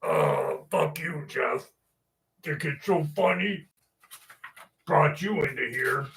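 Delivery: lightly voiced offended dad; mock-resentful dad muttering at his son; imitated grumbling dad. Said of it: "My dad's gonna hear that and be like".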